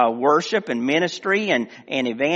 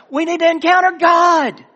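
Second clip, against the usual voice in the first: impassioned preaching; 13 semitones higher, 8 dB louder than usual. Real, not acted. Preacher urging the church to meet God, impassioned. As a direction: proclaim it at full force to the congregation.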